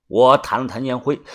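ordinary delivery

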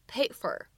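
paid for with the d held but not released; incomplete plosion. Shown as a demonstration. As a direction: swallow the d in "paid for". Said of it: "不完全爆破".

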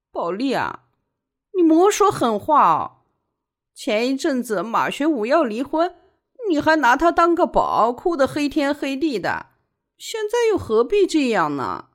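high, weepy remonstration; wailing, plaintive appeal; tearful pleading. A narrator's reading. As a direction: reason with her while crying. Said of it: "万小景还是哭".

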